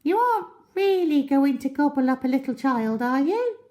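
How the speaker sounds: very high, loud pleading squeak; monkey pleading in alarm; high anxious monkey. Acted. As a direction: beg him not to do it.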